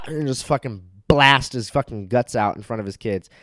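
ordinary delivery

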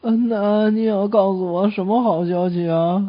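slow, drawn-out patient voice; seriously ill patient. Acted. Seriously ill patient questioning the doctor's good news, slow and drawn out. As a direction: play a very sick man dragging out the question. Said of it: "住着一个重病号".

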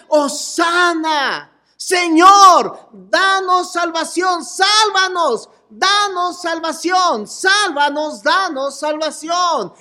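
desperate plea. Retold or imitated. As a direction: beg the Lord for rescue with everything. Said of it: "Esa era la desesperación que decían".

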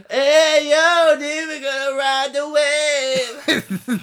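high, drawn-out surfer turtle voice; Crush. Pretend. Contestant's shaky turtle impression, stretched high surfer call. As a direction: do a loud, badly faked surfer-dude turtle. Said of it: "Was that supposed to be Crush?".

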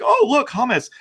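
high, loud delighted gasp; thrilled to spot the hummus; excited discovery. Acted. Judas voiced spotting the hummus.

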